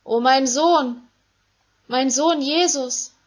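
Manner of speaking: Mary crying out in grief for Jesus; anguished lament. Acted. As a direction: lament over him, drawn out. Mother laments her son.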